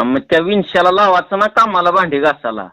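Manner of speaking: usual speaking voice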